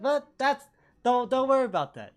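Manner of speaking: high, loud hurried brush-off; nervous deflecting. Acted. Anson deflects angry Keisu.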